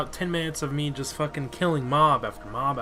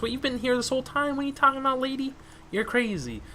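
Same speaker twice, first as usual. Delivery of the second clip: high mock disbelief at the lady; incredulous high voice; 10 semitones higher, faster than usual. Player mocks the lady NPC with a high incredulous voice. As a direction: scoff at her, pitched up.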